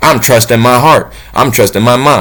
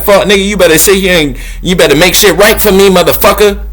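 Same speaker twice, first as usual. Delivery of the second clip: mock cussing out; 6 semitones higher than usual. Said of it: "I'll be like".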